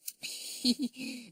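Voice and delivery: sudden high-pitched giggle; girlish titter in Tessie's character; little giggle. A narrator's reading. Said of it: "gave a sudden little giggle".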